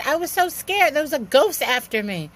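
frightened pleading, pitched well up. Retold or imitated. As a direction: blurt it out like you're still spooked. Telling boyfriend about the ghost.

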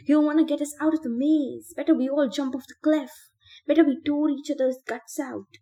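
high, loud mad rant; manic ranting. Acted. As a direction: rave it out of control. Ben ranting madly.

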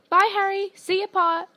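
high, loud farewell call; calling out goodbye. A narrator's reading. Schoolmates calling goodbye to Harry at the gateway, calling out. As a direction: call out goodbye across a crowd. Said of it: "Some of them called".

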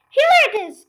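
high, loud cry of triumph; excited cry. A narrator's reading. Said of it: "cried Mister Clee Cranky".

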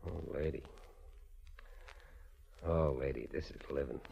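low, soft and dreamy, almost murmured; swooning. Acted. Gangster holding the lady close, murmuring softly. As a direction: melt into her arms, quietly blissful.

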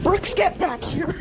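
high, drawn-out call after a runaway. Real, not acted. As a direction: call them back from a distance. Calling after runaway Brooks.

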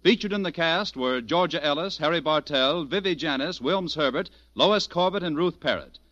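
ordinary talk, nothing marked

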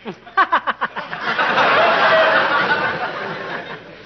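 sheepish laugh. Acted. Catches himself, laughs it off.

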